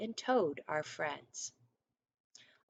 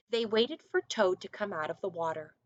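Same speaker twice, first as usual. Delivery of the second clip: usual speaking voice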